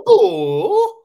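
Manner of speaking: very high, loud mock Swiss-accented boo. Cohost repeats his joke Swiss boo from the bench, very high mock boo. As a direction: squeal a silly Swiss boo. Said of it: "my Swiss boo".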